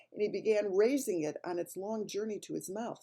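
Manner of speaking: plain reading voice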